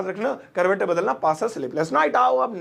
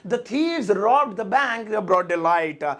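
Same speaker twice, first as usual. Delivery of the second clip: very slow, every word drawn out; slow model sentence; much slower than usual. Shown as a demonstration. Teacher slowly models the translated sentence. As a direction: dictate it slowly to the class. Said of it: "आप जैसे मुझे कहना".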